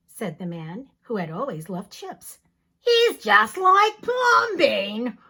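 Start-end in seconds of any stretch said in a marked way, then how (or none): 2.8-5.2 loud, very slow dismay; exasperated neighbors. Acted. Neighbors giving up on the man, loud and very slow. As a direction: drag it out, louder and higher. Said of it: "the people said".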